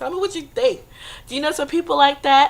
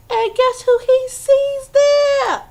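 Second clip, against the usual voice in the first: dramatic buildup; 13 semitones higher, 7 dB louder, much slower than usual. A narrator's reading. Storyteller builds to punchline.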